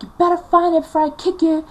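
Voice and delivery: loud, high-pitched threat; furious. Retold or imitated. Mum threatening daughter over lost remote, furious. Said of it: "getting so furious".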